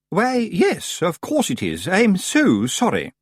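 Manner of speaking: high, drawn-out fake apology; prim Missus Earwig. A narrator's reading. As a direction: apologise sweetly, not meaning it.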